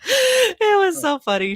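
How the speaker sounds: high, loud laugh-crying; laughing hard. Real, not acted. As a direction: crack up while saying it.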